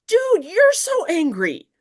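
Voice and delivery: high, slow exasperated retort; dragged-out disbelieving jab; incredulous dude. Retold or imitated. Answering Cole's letter, high and exasperated. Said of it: "And I'm like".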